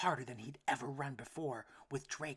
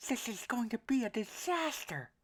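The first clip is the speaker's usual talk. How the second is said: a small worried duck, pitched up; duckling voice; 11 semitones higher, 9 dB louder than usual. A narrator's reading. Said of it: "thought Elmer".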